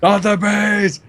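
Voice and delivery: Nicolas Cage scream. Pretend.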